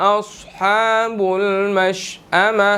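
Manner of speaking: very slow melodic tajweed recitation; Quran recitation. Shown as a demonstration. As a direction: draw each word out in chant. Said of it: "recites the verse, teacher". (धीमी सुरीली तिलावत)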